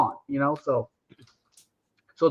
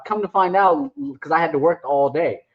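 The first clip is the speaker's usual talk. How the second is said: ordinary talk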